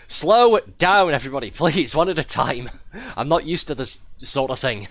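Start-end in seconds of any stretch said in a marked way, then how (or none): 0.1-2.7 loud, high, pleading call for order; exasperated shout. Real, not acted. Streamer telling viewers one at a time, exasperated shout. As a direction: call out over an unruly crowd, flustered.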